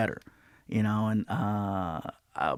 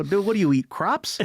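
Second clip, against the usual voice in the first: higher, incredulous comic retort; mock incredulous; 8 semitones higher than usual. Comic retells ribbing his vegetarian friend, rising mock disbelief. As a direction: rib a friend in disbelief, pitch climbing. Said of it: "I go".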